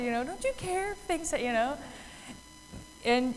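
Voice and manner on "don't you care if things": lightly voiced complaining kid, high and soft; a hint of a child pleading to be noticed; soft, high mock-childish protest; kid's complaint. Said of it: "It reminds me of my kid".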